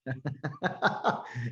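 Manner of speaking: real burst of laughter; hearty laugh. Real, not acted. Speaker recalling being called broke on a plane, real laughter. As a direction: laugh at your own punchline.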